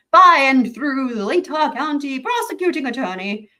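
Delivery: high, loud, hammed-up funny voice; funny reading voice. Host playfully reading a court filing heading aloud, funny voice. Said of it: "read this in a funny voice".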